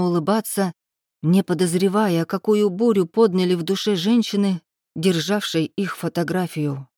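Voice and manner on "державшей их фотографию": ordinary delivery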